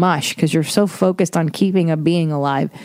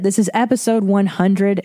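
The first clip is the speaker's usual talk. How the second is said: normal talking voice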